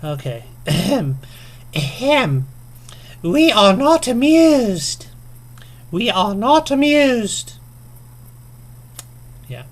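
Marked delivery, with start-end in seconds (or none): 0.7-2.5 loud, theatrical "ahem"; mock throat-clearing. Vlogger preparing the queen's voice, theatrical throat-clearing. 3.2-7.5 very high, loud, slow mock queen; queenly voice. Vlogger saying the tag's phrase, mock queenly voice. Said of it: "in your best queen, queenly voice here".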